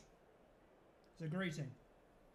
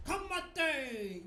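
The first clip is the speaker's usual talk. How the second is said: a loud, hammed-up war cry, pitched higher; mock haka shout; 8 semitones higher, 6 dB louder than usual. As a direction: bark out the haka opening as a joke. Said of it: "and go".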